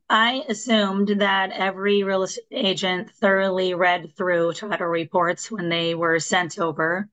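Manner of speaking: ordinary delivery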